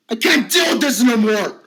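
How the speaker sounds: shouting that he cannot cope; despairing shout. Acted. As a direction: cry out at the end of your rope.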